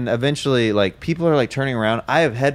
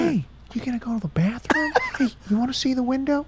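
high, hushed coaxing imitation; soft-edged, high put-on soothing voice; Owen Wilson; 13 semitones higher, 4 dB quieter, faster than usual. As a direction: coax a kid in a pitched-up actor voice. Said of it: "like Owen Wilson calming kids down on planes".

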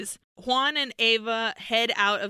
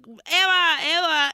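calling Eva out loud, raised and higher; yelling a name; 7 semitones higher, 5 dB louder than usual. Retold or imitated. Juan and Van Helsing yelling Eva's name. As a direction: shout her name across the abbey. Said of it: "they're yelling".

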